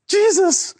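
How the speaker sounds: high-pitched urgent call; freaked-out cry. Acted. Disciples waking Jesus in the storm, freaked-out cry. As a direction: wake him up in a panic. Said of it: "they were freaked out".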